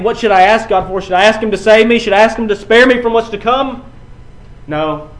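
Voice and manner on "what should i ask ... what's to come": louder, higher pleading questions; crying out to God. Acted. Jesus before the cross crying out, louder pleading. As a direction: plead upward, each question climbing. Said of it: "this is the man crying out".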